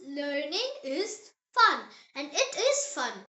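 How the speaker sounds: ordinary talk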